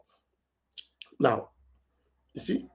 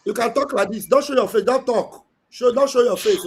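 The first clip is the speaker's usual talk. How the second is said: louder, insistent instruction; firm repeat; 4 semitones higher, 3 dB louder than usual. Real, not acted. Pastor to a caller who ignores him, louder and insistent. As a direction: press the point home so he hears. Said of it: "Eh? then repeats louder".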